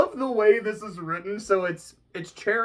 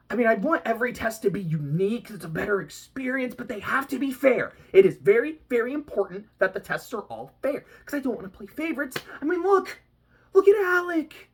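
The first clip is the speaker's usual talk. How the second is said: put-on higher, eager little-girl voice; childish Bonesaw; 7 semitones higher than usual. Vlogger voicing the child Bonesaw answering Jack, eager. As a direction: play the giddy child villain.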